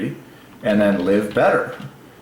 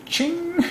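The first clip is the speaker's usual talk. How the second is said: squeaky high ding noise; high ching sound; 4 dB quieter than usual. Real, not acted. Marking a win for father, high ching. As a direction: ring it like a bell. Sound effect after laugh.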